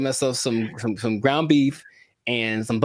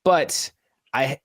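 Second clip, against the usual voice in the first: ordinary talk, nothing marked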